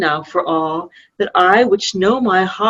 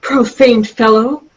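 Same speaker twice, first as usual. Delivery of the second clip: scornful anger; 3 semitones higher, 4 dB louder than usual. Acted. Princess scorns Cloten.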